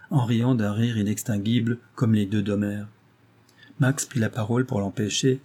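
plain reading voice

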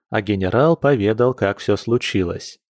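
plain reading voice

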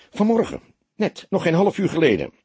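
high, eager reply; keyed-up, bright-eyed eagerness; excited answer. A narrator's reading. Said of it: "riep hij opgewonden".

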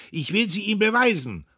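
high, loud, frenzied accusation; passionate shout. A narrator's reading. Dietrich accuses old Buck, shouting in a passion. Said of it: "schrie er, tanzend vor Leidenschaft".

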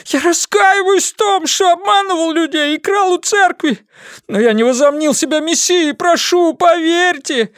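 high, frantic pleading; panicked begging. Acted. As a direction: beg desperately for mercy. Prisoner repents and begs.

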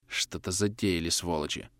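low, hushed suspicious murmur; whispering. A narrator's reading. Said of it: "прошептал Григорьев". (приглушённо, почти шёпотом)

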